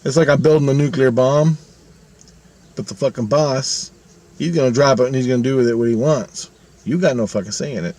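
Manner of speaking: ordinary talk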